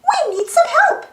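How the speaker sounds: high, sweet Minnie Mouse voice calling out. Acted. Minnie calls Super Toodles for help, high cartoon voice. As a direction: call out high and bright like Minnie. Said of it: "calls Minnie".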